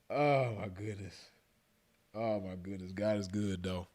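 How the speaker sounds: soft, drained murmur; spent from laughing. Real, not acted. Storyteller thanking God after laughing hard, spent and soft. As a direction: wind down quietly after a laughing fit.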